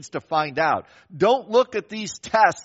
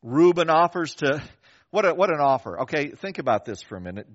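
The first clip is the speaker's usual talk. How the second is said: normal talking voice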